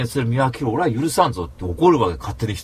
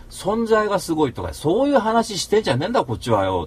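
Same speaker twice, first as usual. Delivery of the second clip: raised, heated pitch; retold rant; 6 semitones higher than usual. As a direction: rant as if back in the argument. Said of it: "つって怒ってるわけ".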